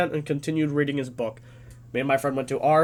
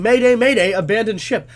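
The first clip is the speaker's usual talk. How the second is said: raised voice, short of a scream; retold screaming; 5 semitones higher, 6 dB louder than usual. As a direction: re-enact the alarm at half strength. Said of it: "he was screaming".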